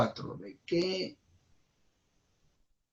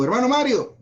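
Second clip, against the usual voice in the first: calling out a name; 9 semitones higher than usual. Shown as a demonstration. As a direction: summon brother Mario aloud. (llamado fuerte y agudo)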